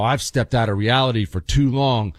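ordinary talk

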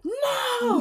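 high, loud telenovela father cry; dramatic dad. Retold or imitated. Dad cries out at daughter, telenovela-style shout. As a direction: ham up dad's melodrama like a soap. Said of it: "It was like a novela, yo".

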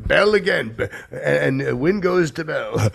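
high, loud crowing announcement; excited win call. Acted. Comic boasting about his own funny comeback, excited and loud. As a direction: crow it out, high and loud, laughing after.

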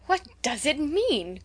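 slow, high-pitched bewildered question; baffled cry. A narrator's reading. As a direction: cry out in puzzlement, stretching it out. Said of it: "cried the girls".

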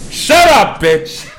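high, loud retort at the TV; mock angry shout. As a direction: shout her down in mock outrage. Yells back at wife on TV.

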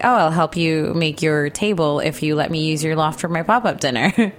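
ordinary delivery

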